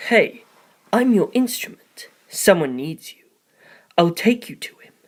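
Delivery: otherworldly, ending in a whisper; a strange cryptic stranger, fading to a hush; eerie Tsukumajuku voice. A narrator's reading. Tsukumajuku cryptically summons the narrator, an eerie whisper. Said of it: "That's what Tsukumajuku had said".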